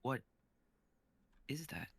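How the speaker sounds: quiet shock. Acted. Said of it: "Luke being played by".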